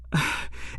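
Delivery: a long unvoiced sigh of disbelief; exasperated sigh. Real, not acted. Sighing at the show's writing. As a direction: let out a weary breath at the silly plot.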